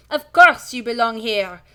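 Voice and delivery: stern French schoolmistress greeting the class; French accent. Acted. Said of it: "She's French as well".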